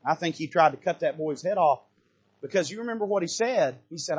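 ordinary talk, nothing marked

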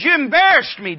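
high, loud acted rebuke; scolding wife. David's wife to David after his dancing, loud scolding. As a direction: throw it at him as an indignant complaint.

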